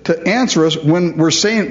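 usual speaking voice

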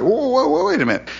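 high-pitched whoas, then settling; mock surprise. Preacher halting his Bible reading, mock surprise. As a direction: break in as if caught off guard.

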